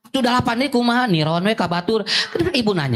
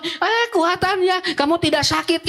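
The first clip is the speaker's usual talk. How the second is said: dismissive parent; 8 semitones higher than usual. Retold or imitated. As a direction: brush off the child's pain in a pushy tone. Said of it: "Itu salah".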